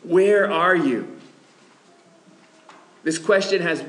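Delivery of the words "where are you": "Where are you?" slow and aching, voice heavy with hurt; aching plea. Shown as a demonstration. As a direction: ask it like a betrayed lover. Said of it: "We, we don't hear the ache".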